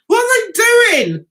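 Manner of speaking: high, loud disbelieving cry; incredulous shout. Real, not acted. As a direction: shout it in shock at the tops. Exclaiming at the revealing tops.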